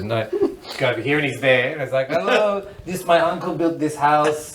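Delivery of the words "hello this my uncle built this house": slow, halting mock Moroccan accent; imitated Moroccan accent. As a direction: imitate a Moroccan guide showing off a house. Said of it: "I can do the accent".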